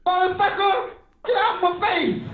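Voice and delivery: high, rough, snapping comic voice; Richard Pryor voice. Retold or imitated. Streamer voicing Syshet as a grumpy comic. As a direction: bark it like an old stand-up. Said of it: "you sound like Richard Pryor".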